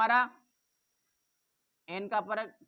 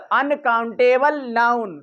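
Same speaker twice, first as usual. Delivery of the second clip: slow, spelled-out term; 11 dB louder, much slower than usual. Shown as a demonstration. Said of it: "ये क्या है सब?".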